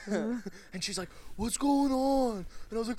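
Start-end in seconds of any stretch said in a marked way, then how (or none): 1.3-2.5 high, loud, drawn-out question; alarmed cry. Retold or imitated. Woman startled by friend's scream, acted alarmed cry. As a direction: imitate someone woken in a panic.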